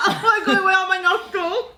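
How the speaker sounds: high, loud laughing outburst; laughing through the words. Real, not acted. Sour candy makes her laugh.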